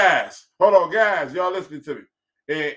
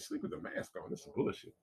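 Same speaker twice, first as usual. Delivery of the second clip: whispered grumble to himself; muttered under breath; 17 dB quieter than usual. Real, not acted. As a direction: grumble it under your breath, barely voiced. Grumbling aside after being told off.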